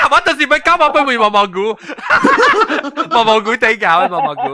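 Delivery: high, loud talking through laughter; real laughing. Real, not acted. Friend joking with co-hosts, laughing hard. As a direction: crack up while getting the words out. Cracking up at own joke.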